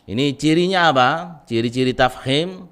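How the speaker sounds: ordinary delivery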